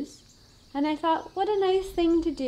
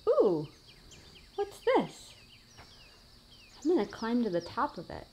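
ordinary talk

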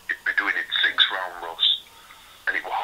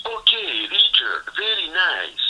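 higher, mock foreign accent; Borat; 9 semitones higher, 4 dB louder, slower than usual. Sparring partner showing off to an unamused boxer, imitated Borat voice. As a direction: imitate a clumsy comic catchphrase impression. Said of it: "Borat impression".

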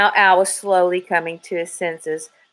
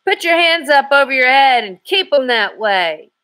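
higher, louder commanding voice; trooper's command; 7 semitones higher, 4 dB louder than usual. A narrator's reading. Trooper surprising the kidnapper, loud commanding voice. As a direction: bark the order from the doorway. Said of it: "another man's voice cut in".

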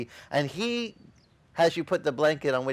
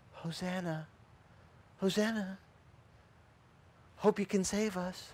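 much softer mock whisper of Hosanna; 4 semitones higher, 10 dB quieter than usual. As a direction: hush it so you don't spook the donkey. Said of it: "Nice soft whisper".

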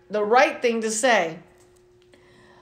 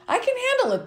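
high bright refrain; 14 semitones higher, faster than usual. Acted. Reading to kids, refrain said high and bright. Child narrator's upbeat refrain.

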